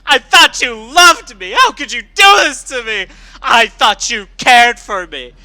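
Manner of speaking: loud, high tearful shouting; crying and shouting. Acted. Scootaloo screaming at her big sister figure, sobbing shouts. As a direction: scream through tears at someone who betrayed you. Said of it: "Tears were pouring down her face".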